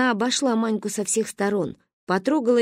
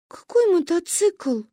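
put-on high, drawn-out child's question; baffled question; 8 semitones higher, slower than usual. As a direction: stretch it out in disbelief. Bewildered child questions friend. (удивлённо, высоко и протяжно)

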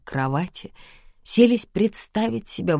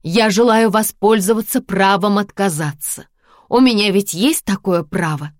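firm declaration; 5 semitones higher, 6 dB louder than usual. Acted. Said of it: "объявила".

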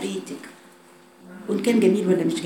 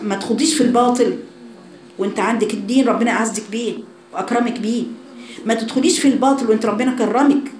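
fervent preaching; 4 semitones higher than usual. Acted. Preacher urging the girls.

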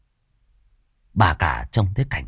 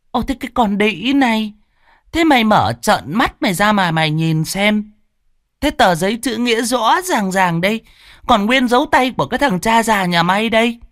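jab every word at her; shrill scolding; 13 semitones higher than usual. A narrator's reading. Mistress pushes servant's face into the paper, shrieking.

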